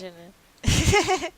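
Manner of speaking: Jaina said as JAI-na; Jaina pronounced right. Shown as a demonstration. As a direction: say Jaina with the stress first. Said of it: "name is pronounced Jaina".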